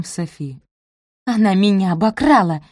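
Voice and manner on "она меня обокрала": ordinary delivery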